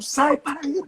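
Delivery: high bellowed taunt; mock yelling. [name] yelling at a friend on his feet, high bellow. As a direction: yell it across the cabin. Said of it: "Imagina os berros".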